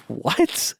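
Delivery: stunned whisper. Real, not acted. Stunned by the Area 51 reveal.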